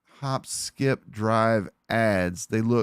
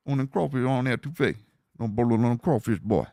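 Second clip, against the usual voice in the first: hammed-up bayou accent, words mashed together; garbled mock-Cajun patter; Louisiana bayou accent. Shown as a demonstration. Streamer riffing on boats and crawfish, in a hammed-up bayou accent. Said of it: "a Louisiana Bayou accent is tough".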